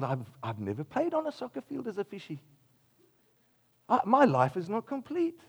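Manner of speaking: mock-plaintive fishy voice; little fish character. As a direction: lament like a little fish longing for land. Voicing a yearning little fish.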